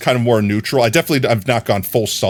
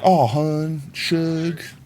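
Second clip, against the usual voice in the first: softer, higher Southern Belle coo; Southern Belle accent; 5 semitones higher, 4 dB quieter, much slower than usual. Shown as a demonstration. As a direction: play a sweet Southern Belle. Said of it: "I think I could emulate that".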